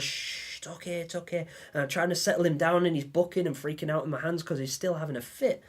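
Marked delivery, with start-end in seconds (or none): 0.0-1.5 hushed, softer shushing to calm a frightened animal; soothing hush. Retold or imitated. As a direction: whisper it's okay like calming a baby. Soothing the seizing joey.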